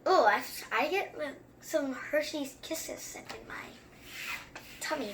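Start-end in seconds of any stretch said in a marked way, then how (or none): none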